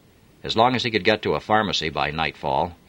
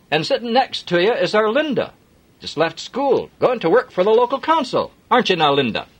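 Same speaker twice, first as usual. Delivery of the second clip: jolly, higher Yorkshire voice, louder; hearty Yorkshire driver; broad Yorkshire accent; 6 dB louder than usual. Acted. Jim offering Adam a lift, broad Yorkshire accent. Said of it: "broad Yorkshire accent".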